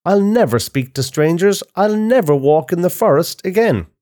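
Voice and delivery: put-on high, upset little-girl voice; crying out. A narrator's reading. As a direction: vow it in a high child's cry.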